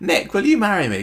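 high, loud play-acted proposal; put-on pleading proposer; mock proposal. Acting out Leanne's proposal to Nick.

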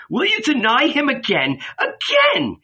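high, rising accusing cry; indignant rebuke. A narrator's reading. Martha accusing Peter of deserting again, indignant high cry.

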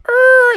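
high, loud imitated tire squeal; car screech. Bucky's family pulling up, high squealing car noise. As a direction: squeal like braking tires.

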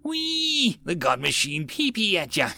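squealed, high "whee" then silly taunt; whooping with joy. A narrator's reading. Gunner joyfully firing at the crowd, whooping. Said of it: "whooped with joy".

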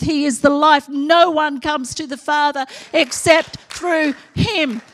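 spitting mid-shout in a loud sermon; spitting while talking. Real, not acted. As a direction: shout so hard you spit. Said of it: "And I just spat everywhere".

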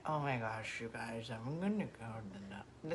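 low, quiet attempt at a Jersey accent; imitating a teenage self's Jersey accent. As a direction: try a mumbled Jersey accent, half-heartedly. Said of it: "it is such a Jersey accent".

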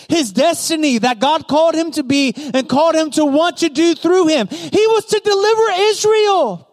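high-pitched, driving proclamation; impassioned preaching. Real, not acted. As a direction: preach it with mounting force. Preaches at full force.

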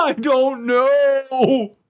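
high, very drawn-out helpless wail; laughing wail. Real, not acted. Player answers teasing about his accent, laughing wail.

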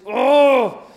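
loud, high groan of mock rage; angry groan. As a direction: let out an exasperated roar. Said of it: "I got so angry so fast".

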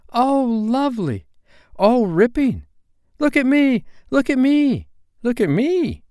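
very high, loud squeals of delight; show-off kids' high shouts; elated children. A narrator's reading. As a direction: cry out like children thrilled to be flying. Children exclaim as they fly.

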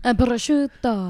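mock European accent on the food name; put-on foreign accent. Friend echoing a fancy food word, mock foreign accent. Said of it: "say it with a French accent".

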